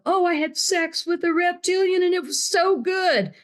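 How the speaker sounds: high, put-on voice mocking a silly claim; mocking quote. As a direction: ham up a ridiculous confession. Mocking ufology stories.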